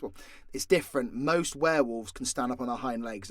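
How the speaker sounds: usual speaking voice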